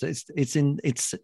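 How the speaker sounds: ordinary talk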